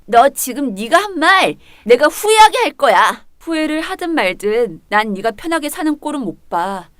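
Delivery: normal talking voice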